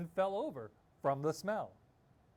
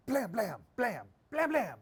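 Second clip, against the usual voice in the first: knocking sounds; 6 semitones higher, 7 dB louder than usual. Acted.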